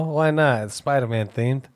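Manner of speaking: ordinary talk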